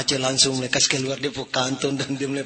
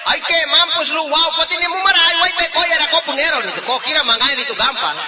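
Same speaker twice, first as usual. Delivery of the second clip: much higher, louder mock scolding; comic yell; 14 semitones higher, 7 dB louder than usual. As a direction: yell at the fish like a cocky fisherman. Fisherman yelling at the fish.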